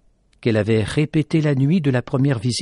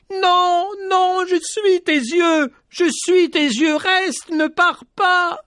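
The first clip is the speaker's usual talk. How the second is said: high, loud, slow pleading cry; crying out desperately; 3 dB louder, slower than usual. Acted. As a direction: beg her to stay with all your strength. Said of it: "il cria de toutes ses forces en pleurant". (supplication criée, aiguë et forte, lente)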